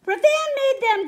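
put-on high, loud, proud boyish announcement; crowing. A narrator's reading. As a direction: crow it with a grin. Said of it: "Tom crowed as he grinned".

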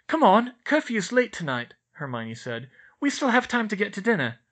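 an eager schoolgirl urging friends on; Hermione, brisk. A narrator's reading.

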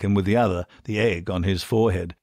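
plain reading voice

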